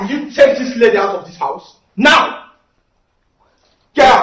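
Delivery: loud, high angry yelling; furious shout. Acted. Son yelling at mother to remove the lady, furious. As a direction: throw her out of the house.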